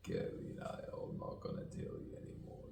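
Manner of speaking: very soft, voiceless muttered confiding; hushed, rambling whisper dying out; secretive whisper. Pretend. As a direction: fade away as if keeping a secret.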